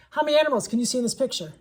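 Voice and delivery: read-aloud voice. Shown as a demonstration. A teacher reading a picture-book question aloud to kids, lively and loud. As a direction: pitch the question up to the class like story time. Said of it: "So I read it".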